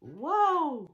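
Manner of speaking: loud, drawn-out waouh; excited wow. Shown as a demonstration. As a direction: belt out the waouh. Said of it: "On peut dire deux waouh".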